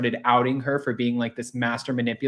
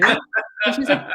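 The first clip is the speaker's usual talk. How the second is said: normal talking voice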